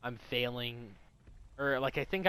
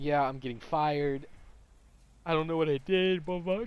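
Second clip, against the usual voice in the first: mock crying; 6 dB louder than usual. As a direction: imitate a teacher bawling over being fired. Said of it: "She's crying".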